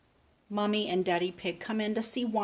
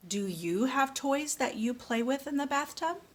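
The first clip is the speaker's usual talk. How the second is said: usual speaking voice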